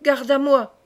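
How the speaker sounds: dying duke cries out to his guards; calling the guard. A narrator's reading. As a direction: shout for the guard in alarm.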